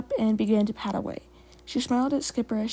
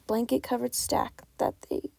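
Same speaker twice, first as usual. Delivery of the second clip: usual speaking voice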